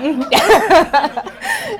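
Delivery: high ringing laugh. Real, not acted. Laughing with the family.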